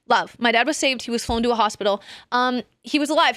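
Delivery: usual speaking voice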